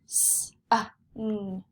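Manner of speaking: s, uh, n one sound at a time. Shown as a demonstration. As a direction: separate "s", "uh", "n" with clear gaps. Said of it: "Do you hear uh?".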